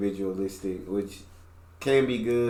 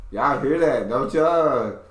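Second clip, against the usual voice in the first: louder, higher hammed-up country accent; mocking country accent; 5 semitones higher, 7 dB louder, slower than usual. Repeating the tease to viewers, exaggerated country accent. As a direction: lay the mock country twang on loud. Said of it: "when I start mocking her accent".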